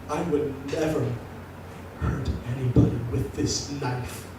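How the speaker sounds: a menacing stranger at the bar, speaking softly; creepy knife guy. Retold or imitated. Creepy knife guy's reply.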